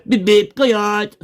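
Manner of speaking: slow, high cartoon beeps; comic catchphrase. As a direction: stretch out the beep gag up high. Rehearses the minister's gag.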